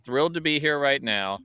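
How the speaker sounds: ordinary delivery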